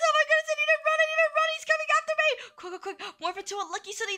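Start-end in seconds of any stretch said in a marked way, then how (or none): 0.0-2.3 high, frantic fleeing voice; panicked. Pretend. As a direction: squeal it while being chased. Player running from the monster.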